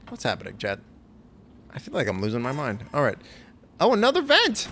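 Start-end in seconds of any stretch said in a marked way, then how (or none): 3.8-4.7 loud, high-pitched delight; excited discovery. Real, not acted. Player spotting another vent, excited. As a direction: exclaim it high and loud at the find. Excited find while exploring.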